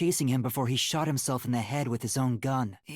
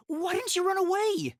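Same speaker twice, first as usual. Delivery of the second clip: alarmed. Acted. Finds Kurumi still there.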